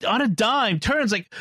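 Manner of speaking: ordinary talk, nothing marked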